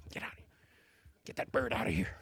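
whispered brush-off, low and dismissive; hushed shooing. Retold or imitated. The child shooing the Holy Spirit away, whispered. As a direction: wave the bird off under your breath.

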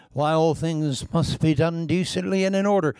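prissy disapproving quote; prim mock. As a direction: sneer at the stiff critics. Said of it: "quoting critics".

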